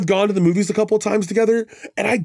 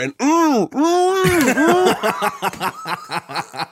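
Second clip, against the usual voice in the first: mock humming; 9 semitones higher, much slower than usual. Mimicking Kidman ad hum.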